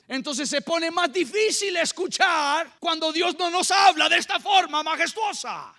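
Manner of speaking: high, slow emphatic preaching; prédica enérgica. Real, not acted. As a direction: proclaim it to the congregation, drawing out each phrase. (predicación exclamada, aguda y pausada)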